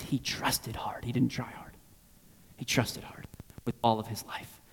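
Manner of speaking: hushed whispered emphasis, slowing down. Real, not acted. Preacher whispering the core truth to the congregation. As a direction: whisper it as the key point.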